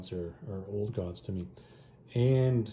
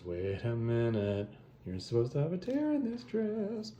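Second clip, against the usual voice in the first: mock dawning realisation; slower than usual. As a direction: stretch it slowly, rising into comic disbelief. Retelling his slow dawning realisation.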